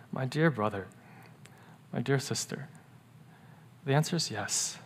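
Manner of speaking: soft, low, gentle answer; hushed and tender pastoral comfort; tender reassurance. Real, not acted. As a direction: reassure them quietly, like a pastor. Tender reassurance after doubts.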